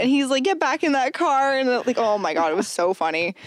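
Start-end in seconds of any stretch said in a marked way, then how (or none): 0.4-1.6 imitated Jamaican accent, high and slow; slow, high mock order in a Jamaican accent; thick Jamaican accent. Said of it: "like, the thickest Jamaican accent".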